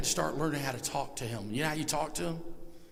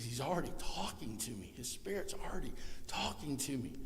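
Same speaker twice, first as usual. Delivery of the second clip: hushed whisper, much softer; 3 semitones lower, 11 dB quieter than usual. Real, not acted. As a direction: confide it in a breath. Intimate revelation aside.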